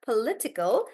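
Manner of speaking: ordinary talk, nothing marked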